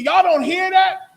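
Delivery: high, loud y'all-don't-hear-that; disbelieving shout at the room; alarmed cry. Retold or imitated. Psychic begging her clients to hear the ghost, loud alarmed cry. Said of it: "And she said".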